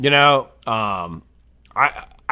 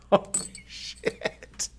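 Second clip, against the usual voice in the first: breathless whispered holy shit; stunned whisper at a mess; whispered curse; 7 dB quieter, much slower than usual. Real, not acted. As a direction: hiss it under your breath in shock. Dropped the cap live on stream.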